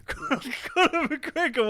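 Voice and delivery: very high, slow drawn-out holler; mock scream, stretching out the name; retold screaming. Attacker circling and screaming a nickname at him, drawn-out high yell. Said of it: "this geezer screaming at me".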